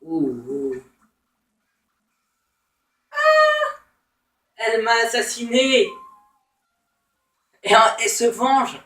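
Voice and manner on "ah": a sudden high yelp of shock; short startled scream; sharp shriek. Real, not acted. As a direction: cry out when you get killed. Killed in game.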